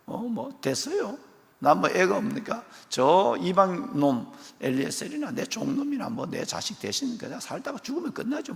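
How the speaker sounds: very high, whiny, dismissive; Abraham sulking that he has no child; petulant Abraham. Acted. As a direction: pout and brush God off. Abraham mocked as sulky.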